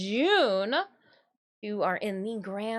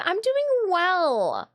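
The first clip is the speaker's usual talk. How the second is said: slow, high cat reply; cat's voice; 8 semitones higher, 6 dB louder, much slower than usual. Pretend. Cat answering the streamer's greeting, slow and high. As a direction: stretch it out as the cat.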